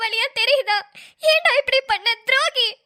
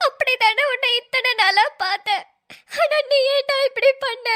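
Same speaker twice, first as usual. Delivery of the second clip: broken laugh-cry of betrayal; sobbing. Acted. Bride sobbing at her friend for betraying her. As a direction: sob out the accusation.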